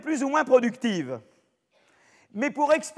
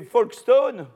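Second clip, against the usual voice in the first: English place name in an English accent; 7 dB louder, much slower than usual. Shown as a demonstration. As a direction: say Folkestone with a showy English accent. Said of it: "mon accent anglais est super".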